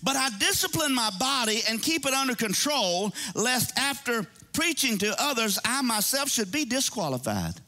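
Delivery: a lightly heightened scripture read. Acted. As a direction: read the verse out with weight. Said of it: "let me read one more scripture".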